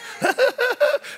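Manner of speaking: rapid, high staccato giggle; put-on uncontrollable church laughter; imitated laughing fit. Rich woman just started laughing, mimicked giggle. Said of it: "she just started laughing".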